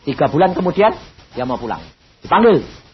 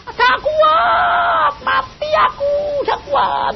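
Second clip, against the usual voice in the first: much higher, crying shout, slow and drawn out; mother's crying scream; slower than usual. Retold or imitated. Mother screaming at night that she can't bear it, crying shout. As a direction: wail it out like a mother in unbearable pain. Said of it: "mamah teriak-teriak".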